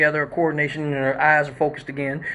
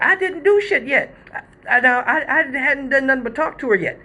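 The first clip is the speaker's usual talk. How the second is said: high defensive protest; 8 semitones higher than usual. Real, not acted. Defending himself against blame.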